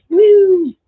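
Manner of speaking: a high, loud cheer of delight; excited woo. Real, not acted. Crafter cheering as she nearly finishes one, whooping loud and high. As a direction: whoop it out, thrilled to be done.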